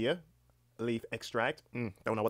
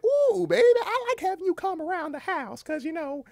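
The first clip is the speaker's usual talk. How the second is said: very high-pitched, coaxing grandma voice; grandmother; 4 dB louder than usual. Acted. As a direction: play a doting grandma in a high put-on voice.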